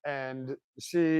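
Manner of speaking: usual speaking voice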